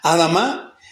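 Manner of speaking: "Adamá" stressed on its last A; "Adamá", accent at the end; stress on final A. Shown as a demonstration. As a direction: lift the last syllable of "Adamá". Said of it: "con acento en la A".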